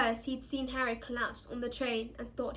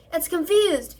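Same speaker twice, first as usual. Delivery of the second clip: loud higher shout; 5 semitones higher, 11 dB louder than usual. A narrator's reading. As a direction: urge the class on, loud. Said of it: "shouted Lupin".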